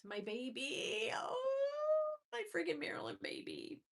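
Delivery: soft, drawn-out coo leaping high; tearful cooing. Real, not acted. Owner finding her dog's photo, tearful cooing. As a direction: ache over a beloved pet, softly. Coos over photo of missed dog.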